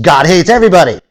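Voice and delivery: high, loud ranting street preacher; mock hate-preacher yelling at the crowd; Fred Phelps rant. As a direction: bellow it like a fire-and-brimstone zealot. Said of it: "like the Fred Phelps of the world".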